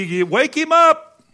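drawn-out taunting yell; loud, high jeering holler; Elijah. Retold or imitated. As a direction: shout it slowly, high and mocking. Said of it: "said that he mocked them".

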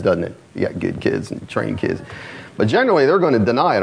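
normal talking voice

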